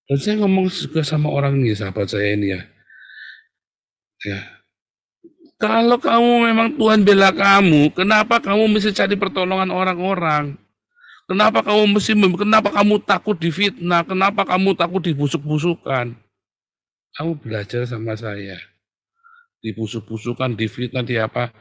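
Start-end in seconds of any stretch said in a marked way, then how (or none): 5.6-16.2 high, loud, insistent questioning; challenging rebuke. Real, not acted. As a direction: raise your voice and challenge them. Challenging the congregation with rhetorical questions. (menegur dengan suara tinggi dan lantang)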